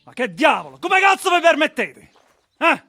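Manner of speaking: high, loud Italian outburst; outraged shout. Acted. Man furious at intruders daring to barge in, outraged shouting. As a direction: explode at the intruders.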